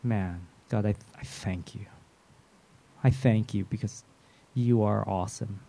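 low, hushed, halting thanks to God; quiet, awed gratitude; grateful prayer. Acted.

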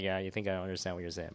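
low, quick muttered aside; lightly voiced sly listener, under the breath; non-Chinese eavesdropper. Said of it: "the non-Chinese guy who's sitting there like".

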